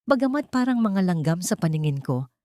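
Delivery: ordinary talk, nothing marked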